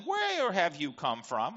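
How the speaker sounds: ordinary delivery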